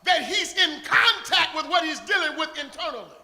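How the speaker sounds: loud rising sermon cadence; full-force preaching. Real, not acted. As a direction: preach it out to the congregation.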